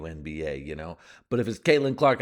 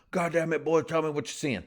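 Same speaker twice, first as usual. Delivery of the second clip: yelling coach; 8 semitones higher than usual. Retold or imitated.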